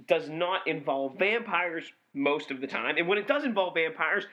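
ordinary talk, nothing marked

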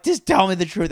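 put-on worked-up kid pressing a parent; retold screaming demand. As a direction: demand it loudly, as if retelling a yell. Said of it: "I remember I was screaming".

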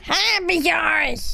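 slow, high croaking cry; croaky shout. A narrator's reading. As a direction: screech it hoarsely like a swamp hag. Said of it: "Mit krächzender Stimme schrie sie".

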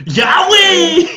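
high shrieking "güey" yell with a laugh; mock shout. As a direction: yell it like the viral clip. Quoting the Edgar video shout.

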